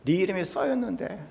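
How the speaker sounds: soft, amused teasing, imitating a teacher; retold chuckling reprimand; laughing teacher. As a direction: tell off a pupil through a laugh. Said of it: "선생님이 웃으면서".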